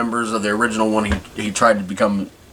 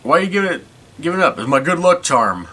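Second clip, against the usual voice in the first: high quoted voice; 8 semitones higher than usual. Retold or imitated. Character quoted retelling movie scene.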